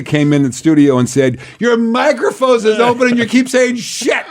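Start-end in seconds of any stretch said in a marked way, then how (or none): 1.6-4.2 high-pitched imitation of a colleague's warning; shrieky retold telling-off, breaking into laughter; mock alarmed scolding. Retells Rita's alarmed studio warning.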